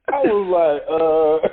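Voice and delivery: laughing through the words; chuckling while telling. Real, not acted.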